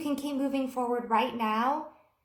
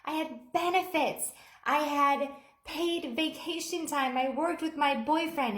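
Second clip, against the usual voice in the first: yelling; 6 semitones higher than usual. Retold or imitated. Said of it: "she starts yelling at Michael".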